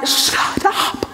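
voiceless, drawn-in gasp of amazement; stunned disbelief. Retold or imitated. Wife seeing three positive pregnancy tests, whispered disbelief. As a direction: breathe it out with no voice, astonished.

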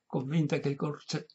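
usual speaking voice

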